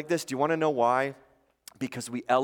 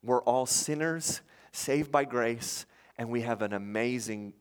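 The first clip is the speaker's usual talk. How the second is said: normal talking voice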